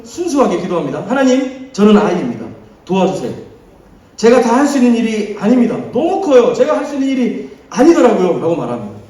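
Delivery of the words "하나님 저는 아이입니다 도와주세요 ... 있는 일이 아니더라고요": loud, higher desperate plea; pleading prayer. Retold or imitated. Solomon crying out to God that the task is too big, loud and pleading. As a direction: cry out to God for help.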